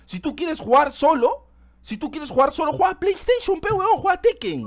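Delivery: high-pitched exasperated outburst; heated rant. Real, not acted. Host telling solo players to play something else, heated high-pitched rant. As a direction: let it climb high as the argument heats up.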